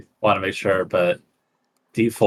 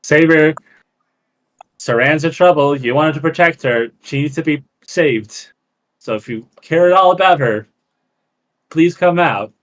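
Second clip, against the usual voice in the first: raised voice, calling out loud; 5 semitones higher, 3 dB louder than usual. Acted. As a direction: shout out to him, urgent. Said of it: "I'd be like".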